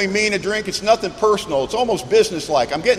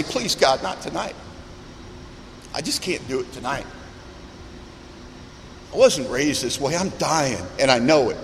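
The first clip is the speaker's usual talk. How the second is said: softer, broken-down plea to God; quiet desperate prayer; 3 semitones lower, 6 dB quieter than usual. Retold or imitated. A worn-out drinker praying to God each morning, quiet and pleading. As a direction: beg God under your breath, worn out.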